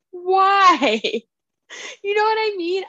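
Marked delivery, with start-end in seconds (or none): 0.1-1.0 long, high-pitched wail of 'why'; laugh, then whine it out in despair; drawn-out, louder, higher complaint; exasperated why. Real, not acted. Laughing exasperation at narrator's squeaky voices.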